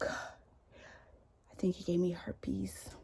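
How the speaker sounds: unvoiced, slow secretive whisper; whispered confession. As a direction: breathe it like a shameful secret. Ex's whispered phone confession.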